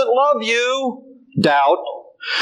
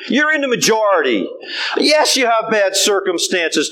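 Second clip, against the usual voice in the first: normal talking voice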